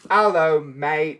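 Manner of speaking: mock Australian accent. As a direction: imitate a bad Australian accent. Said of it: "let [name] keep doing accents".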